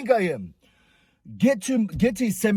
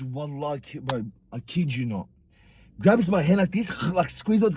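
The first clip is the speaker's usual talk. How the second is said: ordinary talk, nothing marked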